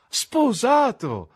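very high, loud, long-drawn cry of joy; cheerful exclamation. A narrator's reading. Narrator echoes his friend's wedding news, cheerful cry. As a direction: echo the news with delight. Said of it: "esclamai allegramente".

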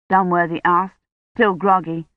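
ordinary delivery

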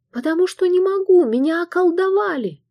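high, fast desperate plea; desperate cry. A narrator's reading. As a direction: blurt it out in panic. Desperate protest to the voice.

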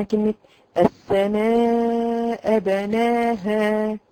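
بناها drawn out, final ha clear; slow correct recitation. Shown as a demonstration. Teacher models the correct بناها for the girls. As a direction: recite بناها slowly, keep the ha. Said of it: "في كلمة إيه مثلًا".

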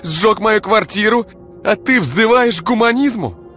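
high, loud, screaming tirade; enraged woman screaming her grievances at her boyfriend; furious rant. Acted. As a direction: hurl every grievance at him. Ira furious rant at Igor.

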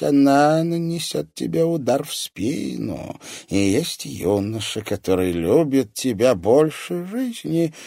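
prophesy like a grim seer; old fortune-teller. A narrator's reading.

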